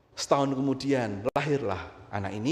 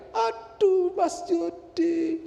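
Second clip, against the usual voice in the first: high, drawn-out mock labour wail; Retno; much slower than usual. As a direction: wail for your husband in mock pain, stretching the name. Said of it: "ketika Retno teriak-teriak". (teriakan tinggi memanjang menirukan ibu melahirkan)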